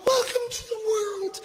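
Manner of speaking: high, tearful imitation of a wife; mock-weepy welcome to a newborn; crying new mother. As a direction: put on a high, choked-up mother's coo. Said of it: "My wife's crying".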